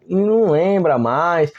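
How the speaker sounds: normal talking voice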